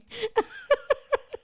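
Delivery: high burst of real laughter. Real, not acted. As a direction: crack up for real. Big laugh at story.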